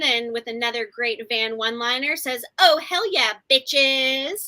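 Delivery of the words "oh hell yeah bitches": higher, louder imitated cheer; acted-out whoop of excitement; mock excited. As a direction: crow it like a triumphant one-liner. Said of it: "excitedly runs towards it".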